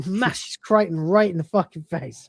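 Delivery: ordinary talk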